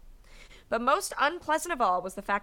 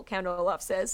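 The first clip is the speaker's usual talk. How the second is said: ordinary talk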